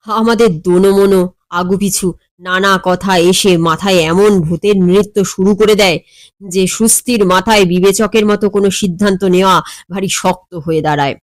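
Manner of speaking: plain reading voice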